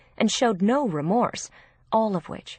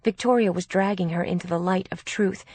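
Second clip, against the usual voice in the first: ordinary delivery, nothing marked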